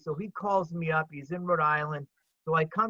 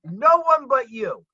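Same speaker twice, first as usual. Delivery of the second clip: emphatic exclamation; 8 semitones higher, 12 dB louder than usual. Real, not acted. Urging the theater's owner on about the rare mezzanine, emphatic shout. Emphatic rhetorical point to Billy.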